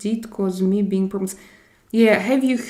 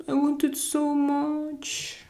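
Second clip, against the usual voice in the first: mock pleading; 8 semitones higher, 5 dB quieter, slower than usual. Real, not acted. Begging chat for seventy likes.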